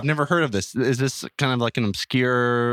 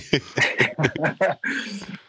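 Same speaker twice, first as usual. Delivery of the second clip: laughing; 3 semitones higher, 3 dB quieter than usual. Real, not acted.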